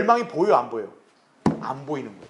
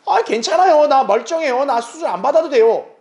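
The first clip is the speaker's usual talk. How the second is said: higher, louder mock brush-off; stubborn patient; 6 semitones higher, 4 dB louder than usual. Patient needing surgery refuses, higher louder denial. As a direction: act the patient waving off surgery. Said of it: "긴급하게 수술하고 치료받을 환자가".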